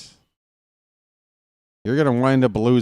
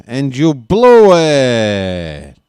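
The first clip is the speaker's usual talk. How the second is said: taunting; 10 dB louder, much slower than usual. Acted. Taunting the opponent.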